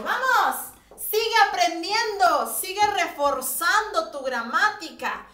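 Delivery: loud, high cheer to keep going; excited cheer. Real, not acted. Teacher cheering online learners to keep studying, loud and excited. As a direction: pump the class up like a coach.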